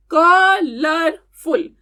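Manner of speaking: कलरफुल in three slow beats, क, लर, फुल; teacher sounding out कलरफुल very slowly; syllable by syllable. Shown as a demonstration. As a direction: stretch and separate each syllable of कलरफुल. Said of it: "तो three syllables हैं".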